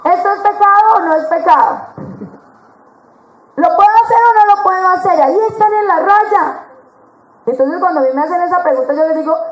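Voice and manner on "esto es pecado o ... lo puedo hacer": put-on doubting girl.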